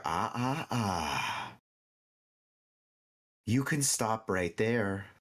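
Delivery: slow, lightly voiced unicorn; a calm unicorn stops the party; gentle unicorn voice. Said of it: "I wanna hear the unicorn voice".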